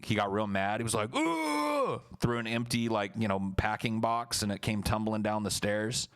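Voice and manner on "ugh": very high frustrated grunt; mock angry grunt. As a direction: squeal it while throwing a box. Yoshi throwing a box.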